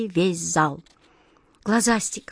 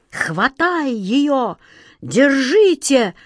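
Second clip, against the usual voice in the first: high, slow drawn-out shout; frantic yelling; 5 semitones higher, 3 dB louder, much slower than usual. A narrator's reading. King ordering the girl seized, yelling frantically. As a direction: holler the orders, stretching each word. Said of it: "как безумный завопил король".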